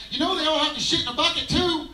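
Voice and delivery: chasing them down. Retold or imitated. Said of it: "I'm like".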